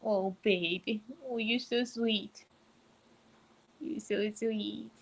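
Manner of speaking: tender murmur to a sleepy pet; soft cooing. Real, not acted. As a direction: coo to him gently and slowly.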